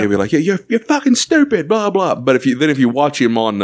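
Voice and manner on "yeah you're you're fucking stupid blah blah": high mock-angry scolding; Gordon Ramsay. Chef scolding someone who messed up, mock angry. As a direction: berate like a fed-up chef. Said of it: "how angry he'll get".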